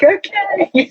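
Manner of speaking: high, bemused, drawn out okay. Retold or imitated. Patient puzzled by the husband's snack, high bemused okay. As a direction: sound baffled but polite. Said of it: "And I'm like".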